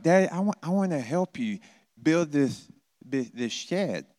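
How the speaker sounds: put-on high eager child voice; kid asking dad. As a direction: play a little kid begging to help. Child voice in story.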